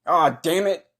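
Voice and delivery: ordinary talk, nothing marked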